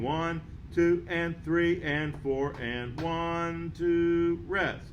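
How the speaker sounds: steady rhythmic count, held on the pitch; counting beats aloud. Shown as a demonstration. Teacher counting the second ending with students, steady rhythmic count. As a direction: count each beat evenly in time. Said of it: "let's say the counts".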